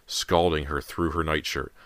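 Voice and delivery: ordinary delivery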